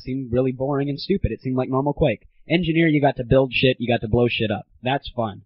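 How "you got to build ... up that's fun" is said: usual speaking voice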